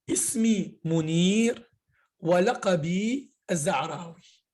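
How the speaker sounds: Ismi Mounir, Walaqabi Zahrawi, slowly and clearly; slow model phrase. Shown as a demonstration. Teacher modelling the introduction for students, slowly. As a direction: say it slow, word by word, to be repeated. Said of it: "je dis tout simplement".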